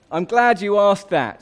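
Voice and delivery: put-on Queen without accent. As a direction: play the Queen in your own accent. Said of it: "I won't do an accent".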